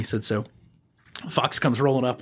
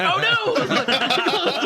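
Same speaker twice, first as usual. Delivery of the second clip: very high mock-horrified cry; comic alarm. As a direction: play sudden dismay through laughter. Reacting to hands in doorway.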